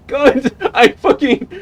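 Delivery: high, loud helpless laughter; laughing hard. Real, not acted. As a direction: crack up while talking.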